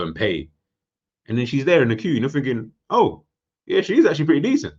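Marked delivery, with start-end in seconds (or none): 2.9-4.7 high, loud, quick inner voice; acted-out pleased discovery; impressed realisation. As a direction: pitch it up as a delighted thought. Voicing thought in the queue.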